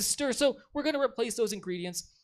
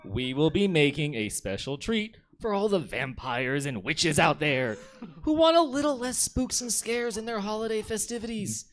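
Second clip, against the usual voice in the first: hammed-up recipe host welcoming the audience; cooking show host.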